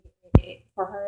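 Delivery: ordinary talk, nothing marked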